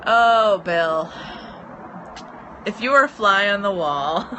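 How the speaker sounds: loud laughing "oh, Bill". Real, not acted. Answering Bill about her cat, loud laughing. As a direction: laugh through the teasing reply.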